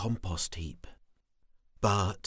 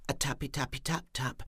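fast; 5 semitones higher, 5 dB quieter, faster than usual. A narrator's reading. Rhythmic tapping refrain.

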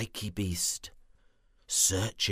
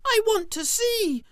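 very high excited squeal; 6 dB louder than usual. Acted. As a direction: squeal with eager delight. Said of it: "squealed Squiggy".

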